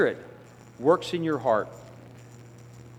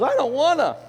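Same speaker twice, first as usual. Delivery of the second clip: put-on high little-kid whine; childish whine; 10 semitones higher, 4 dB louder than usual. Sinner refusing God like a child, high and whiny. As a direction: pout it like a child.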